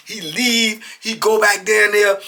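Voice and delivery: ordinary delivery, nothing marked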